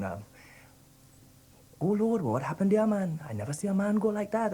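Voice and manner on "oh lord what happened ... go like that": put-on high, lilting Trinidadian accent; a playful Trinidadian impression; Trinidadian sing-song. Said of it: "He has a way of going up and down".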